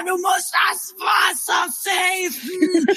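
high, slow, scolding; mock warning about wasting water; scolding relative.

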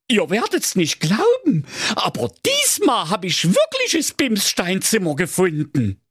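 high, excited put-on voice; eager count voice. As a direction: call it out like a proud fool. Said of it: "Graf Spacko rief".

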